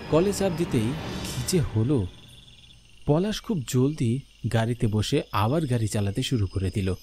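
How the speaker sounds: plain reading voice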